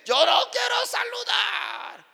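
high, loud, drawn-out refusal; angry shouting. Retold or imitated. Husband refusing to greet the pastor, angry shout. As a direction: shout him down in mock fury.

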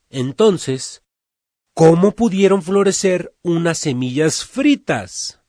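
loud, high address to the crowd from a height; royal proclamation. Acted. The king speaking to his people from a high place, loud proclaiming voice. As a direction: proclaim it to the whole town.

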